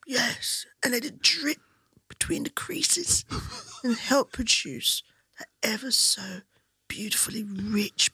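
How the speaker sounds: breathy, seductive posh pitch to the sponsor, dropping to a whisper. Pretend. Podcast guest pitches Chapel Down in a mock posh seductive whisper. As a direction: purr it like a sultry posh lady selling wine. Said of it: "let me do my posh voice".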